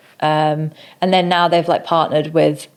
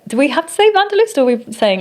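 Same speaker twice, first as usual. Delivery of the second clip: much higher, quick querying voice; retold questioning; 9 semitones higher, faster than usual. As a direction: re-enact a hurried, high-pitched question. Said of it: "and I was like".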